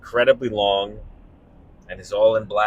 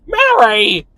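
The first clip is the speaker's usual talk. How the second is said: much higher, louder desperate call; It's a Wonderful Life Jimmy Stewart; 13 semitones higher, 9 dB louder than usual. Retold or imitated. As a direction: cry out for Mary like the old film. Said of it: "It's a Wonderful Life Jimmy Stewart".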